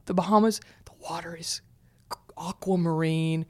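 breathy whisper, as if in awe; hushed whisper. Real, not acted. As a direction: whisper it like the view takes your breath. Hushed, describing the beautiful water.

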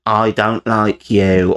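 slow, drawn-out ghostly murmur; slow spooky EVP voice. Retold or imitated. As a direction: stretch each word like a recording from beyond. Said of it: "things like".

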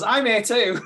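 high, loud call-out; mock announcement. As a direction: pop up and announce yourself, imitating an eager gatecrasher. [name] pops out announcing himself.

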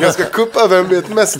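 laughs through the plan, pitch up and loud; chuckling at his own scheme; laughing. Real, not acted. Telling friends his sneaky plan, laughing.

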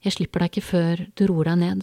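plain reading voice

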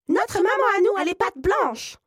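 put-on high, loud children's shout; kids yelling. A narrator's reading. Seven kids shout at the wolf through the door, high yell. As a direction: yell it all together as tiny kids. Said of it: "hurlent les sept biquets".

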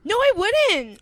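high, loud, slow insistent no; emphatic denial. Real, not acted. Co-host insisting she'd keep podcasting, loud emphatic denial. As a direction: shut the idea down hard.

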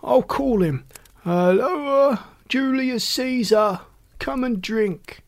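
drawn-out roar, pitched high; hoarse roar. A narrator's reading. Drunkard summoning his associate, roaring hoarsely. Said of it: "As he hoarsely roared this out".

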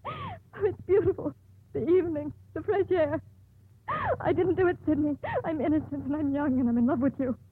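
cling to life, terrified of dying; desperate pleading. Acted. Condemned woman pleads desperately to live.

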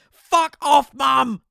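high, loud yell at mum; furious shout. Acted. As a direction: scream at her to leave. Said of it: "I cried".

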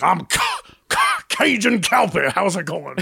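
put-on stuttered, drawn-out New Orleans waiter accent. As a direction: stumble into your name in a mock New Orleans accent. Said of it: "And he's like".

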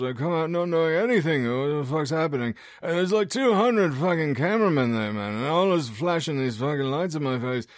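garbled, high-pitched, sweary rocker recounting his confusion. Acted. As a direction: tell it as a bewildered, barely intelligible heavy metal star. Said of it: "if you didn't understand any of that".

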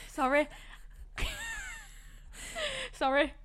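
apologising through giggles; can't stop laughing, high and breathless. Real, not acted. Prank call breaking into laughter.

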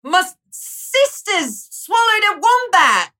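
high mock Australian, slipping British; bad Australian accent. Host failing an Australian accent, mock Australian. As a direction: attempt Australian and miss. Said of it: "that was kind of British".

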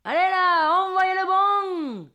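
high, drawn-out bingo holler; imitated neighbour's yell. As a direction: urge the caller on, stretching every word. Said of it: "j'entends mon voisin hurler". (voisin qui hurle en traînant)

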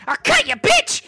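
shrieking, very high loud threat; kid's threat. Acted. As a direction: scream the threat like a feral child. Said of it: "and he goes".

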